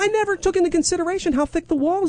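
high, quick mocking neighbour voice; rushed, high-pitched mock condescension; condescending. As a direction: imitate a patronizing excuse. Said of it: "completely being condescending".

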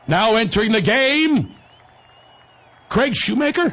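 slow stadium announcer, "Craig Shoemaker" rising high in doubt; announcement as question. Retold or imitated. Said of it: "Said it as a question".

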